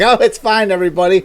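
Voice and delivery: high, loud mock reassurance; mocking the government. Mocking what the governments would say, high and loud. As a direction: parody a smug official calming everyone. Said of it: "It's like".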